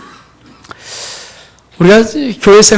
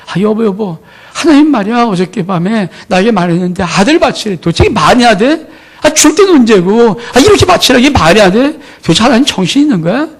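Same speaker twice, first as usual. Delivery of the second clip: higher, louder mock outrage, building after the opening; hammed-up disbelieving protest, pitched up; acted indignant outburst; 5 semitones higher, 4 dB louder than usual. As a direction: play a husband venting to his wife about God. Imagined husband's outraged complaint to wife.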